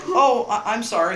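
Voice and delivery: high, loud startled apology; mock flustered fan backing off. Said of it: "And she's like".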